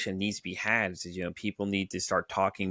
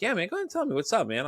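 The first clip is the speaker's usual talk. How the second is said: much higher, eager urging to talk; eager encouragement; 11 semitones higher, faster than usual. Retold or imitated. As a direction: coax a friend to open up. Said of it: "I'm like".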